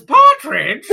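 high, loud mock outburst; laughing, hammed-up shouted question; excited king. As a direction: blurt it out like a thrilled king. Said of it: "The king goes".

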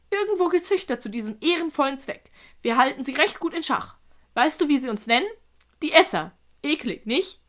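a hint of a chatty creature; talkative spider. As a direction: explain it with animated relish.

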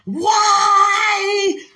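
loud high cry of why. Retold or imitated. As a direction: wail it out to the sky. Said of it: "many be like".

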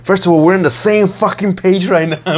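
high, indignant retort with a laugh; sassy comeback. Retold or imitated. Answering the angry classmates, high sassy indignation. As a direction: throw it back at them, amused.

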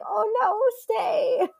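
high, mock crying plea; mock wail. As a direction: wail it like a heartbroken mom. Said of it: "it's like".

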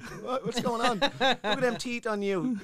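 high, loud laughing taunt; laughing mockery. Mocking the rider's teeth, laughing and loud. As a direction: jeer through laughter at his teeth.

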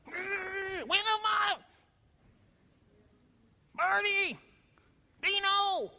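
high shouted cartoon yells, each name its own outburst; angry Fred Flintstone. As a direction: bellow the names like a furious cartoon dad. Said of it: "he'd get so angry his face would get red".

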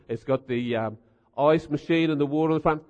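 ordinary delivery

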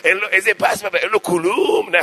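rising outrage of a fussy Persian elder; old Persian man. Retold or imitated. Old Persian man protesting the haircut, mock Persian accent. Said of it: "היה שם איזה פרסי אחד בן שישים".

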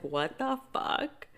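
high, slow stunned what the fuck; drawn-out disbelief. Retold or imitated. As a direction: stretch it out, stunned and quiet. Reaction to business closures.

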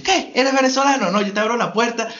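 high, eager, rushing voice; excited bank clerk. Retold or imitated. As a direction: gush like a thrilled clerk.

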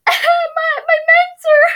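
high loud mock wail; overacted grief, shouted high; hammed-up anguished cry. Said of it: "reenacted by a totally qualified actor".

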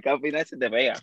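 usual speaking voice

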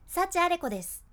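ending dropped hard and heavy. Shown as a demonstration. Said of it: "今極端にやってます".

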